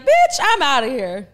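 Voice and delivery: loud, slow, put-on indignation; mock storming off. Host acting out someone quitting mid-act, indignant. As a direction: declare it loudly, dragging the words.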